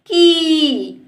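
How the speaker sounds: की drawn out on a long ee. Shown as a demonstration. Teacher reads का plus matra for pupils, drawn out. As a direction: hold the vowel of की firmly. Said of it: "तो इसको बोलेंगे".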